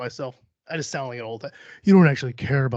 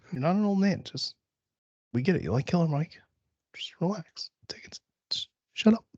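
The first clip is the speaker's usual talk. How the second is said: hushed, whispery self-soothing; ASMR whisper; 4 semitones higher, 4 dB quieter than usual. Pretend. Host talking themself down from a rant, ASMR whisper. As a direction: whisper calming words to yourself. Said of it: "Fucking A S M R now".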